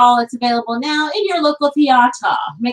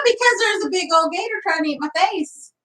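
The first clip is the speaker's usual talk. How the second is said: hillbilly voice, pitched up; hillbilly accent; 7 semitones higher than usual. Acted. Wife slips into a hillbilly accent telling of the gator. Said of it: "Why did you suddenly turn into a hillbilly?".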